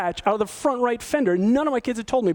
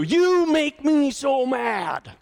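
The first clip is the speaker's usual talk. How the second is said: slow, higher accusing "you" statement; accusing complaint; 4 semitones higher, much slower than usual. Shown as a demonstration. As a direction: blame them, drawn out. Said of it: "Don't start with you".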